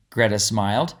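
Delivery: usual speaking voice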